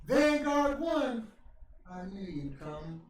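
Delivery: high, loud, slow theatrical greeting; dramatic villain greeting. Retold or imitated. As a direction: greet it like a long-awaited nemesis. Jericho dramatically greets the drone.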